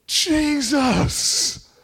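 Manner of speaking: loud, high shout of a name; shouted cry. Acted. Preacher showing how he cries out to Jesus, shouted cry. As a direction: cry it out suddenly at full force.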